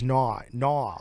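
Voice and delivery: a tentative stab at an Australian "no"; Australian accent attempt. Touring musician has a go for the interviewer, shaky Australian accent attempt. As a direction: try an Australian accent, not quite landing it. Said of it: "Australian accents. I'm terrible at it".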